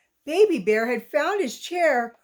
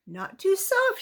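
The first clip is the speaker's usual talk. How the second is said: put-on raised girlish voice testing the bed; contented little voice; higher, pleased; 8 semitones higher than usual. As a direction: weigh it up happily. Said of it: "she said".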